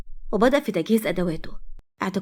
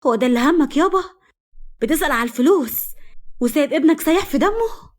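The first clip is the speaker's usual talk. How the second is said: higher-pitched, raised indignant questions; daughter's reproachful yell; 6 semitones higher, 4 dB louder than usual. A narrator's reading. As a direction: cry out at your father, voice climbing. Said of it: "صرخت فيه بنته".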